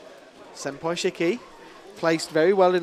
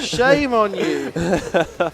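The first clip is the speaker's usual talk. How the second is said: very slow, laughing fake reproach; laughing mock scolding; 2 semitones higher, 5 dB louder, much slower than usual. Real, not acted. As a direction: drag it out, laughing, as a joke. Teasing co-caster.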